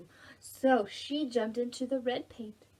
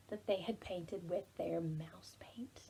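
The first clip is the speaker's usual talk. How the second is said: whisper; 5 semitones lower, 11 dB quieter than usual. Acted. Story ending hushed.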